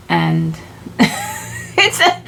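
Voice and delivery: real laugh breaking into the words. Real, not acted. Speaker amused by her silly chair example, laughing. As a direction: laugh as you try to go on.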